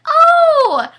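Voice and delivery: high, loud squeal of recognition; a sudden joyful "Oh!" with a laugh; squealing with joy on seeing a familiar face; delighted cry. Retold or imitated. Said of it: "She was like".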